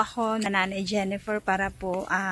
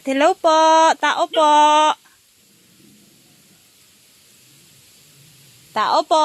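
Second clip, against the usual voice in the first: calling loudly to the house; calling from far away; 5 semitones higher, 10 dB louder than usual. Real, not acted. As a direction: call out so someone inside hears. Knocking at a closed house. (tumatawag mula sa malayo)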